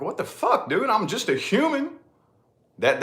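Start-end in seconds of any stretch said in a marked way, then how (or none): 0.0-1.9 exasperated disbelief. Retold or imitated. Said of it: "And you're like".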